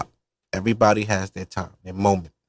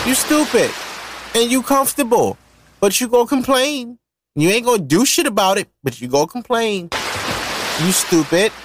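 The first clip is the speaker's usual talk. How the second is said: higher, heated scolding; exasperated rant; 8 semitones higher than usual. Real, not acted. Ranting at women who stay with men they dislike, exasperated. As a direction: scold them like you're fed up.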